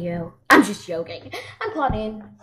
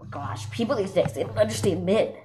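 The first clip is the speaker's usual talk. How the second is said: ordinary talk, nothing marked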